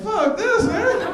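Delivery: high loud exasperated outburst; fed up. Retold or imitated. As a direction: blurt out in frustration. Fed up at end of line.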